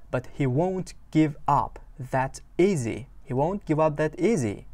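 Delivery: slow, drawn-out English; unhurried teacher read; slow lyric reading. Shown as a demonstration. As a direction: read it slowly for learners. Teacher reads lyric slowly.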